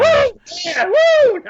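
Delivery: high whooping woo; excited high-pitched woo; cheer. Real, not acted. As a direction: whoop it up like a fan in the crowd. Cheering the guest's name.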